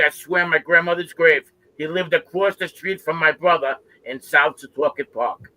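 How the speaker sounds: normal talking voice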